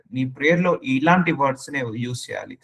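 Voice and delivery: usual speaking voice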